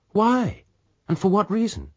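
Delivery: high, alarmed "why"; alarmed disbelief. Acted. Lady to pirate, alarmed and disbelieving. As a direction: she can't believe he'd risk death.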